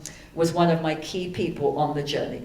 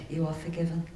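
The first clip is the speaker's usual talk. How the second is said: soft hushed reply, barely voiced; hushed whisper; 9 dB quieter, faster than usual. Answering the colleague who asked forgiveness, softly hushed. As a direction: breathe the words out softly as if in an embrace. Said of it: "And I whispered back".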